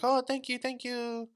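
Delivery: high-pitched mock Chinese accent; botched Chinese accent. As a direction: imitate a grateful lady in a Chinese-tinged accent. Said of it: "more of a Chinese accent".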